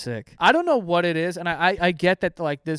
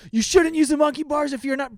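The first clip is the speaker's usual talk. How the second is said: higher nagging mock voice; mocking Karen; 7 semitones higher, 3 dB louder than usual. Imitating the park mom scolding a child, higher mock nag. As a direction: parody the scolding mom, pitched up.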